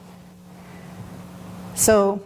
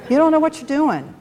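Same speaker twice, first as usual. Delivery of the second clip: raised pitch, fed-up snap of shut up and go away; exasperated retort; 6 semitones higher than usual. Retold or imitated. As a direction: blurt it out like you've had enough. You just feel like saying.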